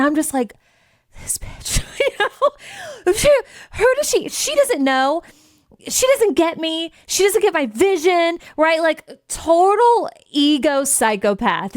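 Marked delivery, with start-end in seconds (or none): 1.2-1.8 hissed under the breath; seething whisper. Retold or imitated. Seething after the call. 2.0-3.1 high laughing aside. Real, not acted. Laughs at own outburst. 3.1-11.8 higher, hammed-up ranting; mock temper tantrum. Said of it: "throwing a total temper tantrum".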